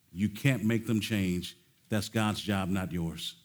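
ordinary delivery

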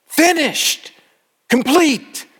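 high, triumphant cry; victorious. Retold or imitated. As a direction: proclaim it in triumph, pitch way up. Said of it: "victory in his voice".

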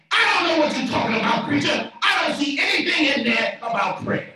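high, loud imitation of a doubter; mock protest. Preacher voicing a doubtful viewer, mock protest. Voices a doubting online listener.

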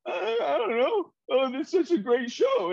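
high, tearful, crying voice; imitating crying. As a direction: choke the words out as if still in tears. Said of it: "Crying while I was about to go on stage".

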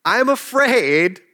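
ordinary talk

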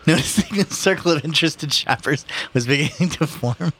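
ordinary delivery, nothing marked

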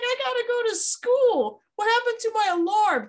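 high-pitched mock alarm; mock panic. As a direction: play a kid suddenly panicking, hammed up. Acts out boy late for school.

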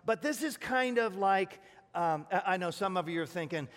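ordinary delivery

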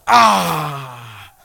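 loud, drawn-out falling "aah"; exasperated cry. Retold or imitated. Preacher reenacting his cart crash, loud exasperated cry. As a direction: act out a long exasperated outburst. Cart hits something, retold reaction.

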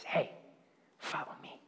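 breathy whispered invitation; an unvoiced, very soft call to follow; hushed whisper. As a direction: whisper it like a gentle summons. God's quiet call, in a hushed retelling.

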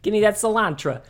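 hammed-up Brooklyn accent. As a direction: put on a bad Brooklyn accent. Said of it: "I don't know how to do a Brooklyn accent".

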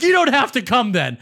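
high, imitated huffy dismissal; mock angry brother uninviting someone; retold angry yell. Brother uninviting caller before hanging up, retold angry yell. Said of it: "you called to yell at me".

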